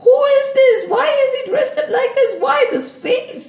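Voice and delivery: amused disbelief climbing to high, loud questions; acted incredulous giggling questions; laughing to herself. Vishwamohini thinking about Narad Muni's odd look, laughing. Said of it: "She was laughing to herself".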